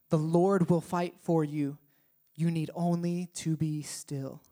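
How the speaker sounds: slow, soft verse read; solemn reading. Shown as a demonstration. Preacher reading Exodus to the congregation, slow and soft. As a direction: let the verse settle slowly.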